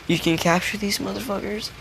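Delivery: high, incredulous squeak of a question; shocked disbelief. Retold or imitated. Hunter stunned to hear monsters can be captured, squeaking in disbelief. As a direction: sound floored that this was possible all along.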